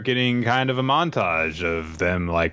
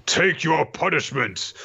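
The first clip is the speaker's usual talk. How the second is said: menacing decree; 9 semitones higher than usual. Acted. Villain god pronounces a punishment.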